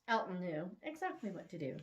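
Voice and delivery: plain reading voice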